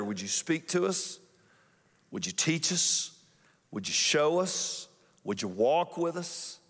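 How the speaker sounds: pastor praying before the sermon; prayer. Acted. Pastor leads an opening prayer.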